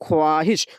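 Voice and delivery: ख्वाहिश as kho-aa-hish, the wav sounded; spelling pronunciation. Shown as a demonstration. Teacher showing what is written, ख्वाहिश with its wav sounded. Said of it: "ये लिखा हुआ है".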